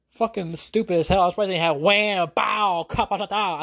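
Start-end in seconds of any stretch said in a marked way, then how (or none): 1.8-3.6 punchy high cartoon sound effects; mock Batman show sound effects; comic fight sounds. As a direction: bang out the old TV fight noises.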